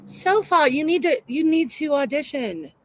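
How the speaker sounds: usual speaking voice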